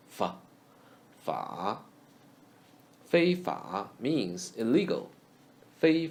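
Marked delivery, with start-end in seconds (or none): 0.1-1.8 fa with a low dipping third tone; Mandarin third tone. Shown as a demonstration. Teacher drilling fa in the third tone. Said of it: "read in the third tone".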